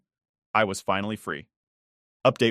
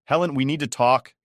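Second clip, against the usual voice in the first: tense; 5 semitones higher, 5 dB louder than usual. A narrator's reading. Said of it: "his voice tense".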